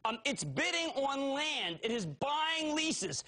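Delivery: drawn out slowly and high; slow emphatic. Acted. Host to viewers, slow and high-pitched drilling pitch.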